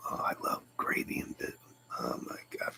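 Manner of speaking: breathy whispered craving for biscuits and gravy; hushed private whisper. Real, not acted. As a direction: whisper it to yourself, longingly. Whispered aside about biscuits.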